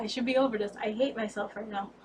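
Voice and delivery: normal talking voice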